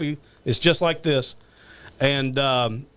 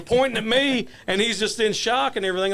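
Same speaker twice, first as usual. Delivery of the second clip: ordinary talk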